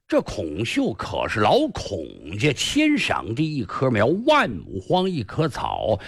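ordinary delivery, nothing marked

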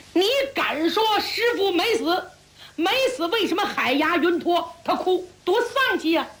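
high-pitched incredulous challenge; dumbfounded disbelief. A narrator's reading. Junior brother doubting that master is alive, dumbfounded disbelief. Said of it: "向文忠也觉得发愣".

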